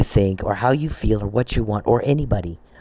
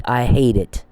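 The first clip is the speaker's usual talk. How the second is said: usual speaking voice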